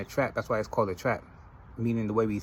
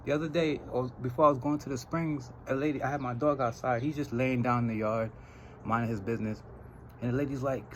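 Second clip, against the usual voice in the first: ordinary delivery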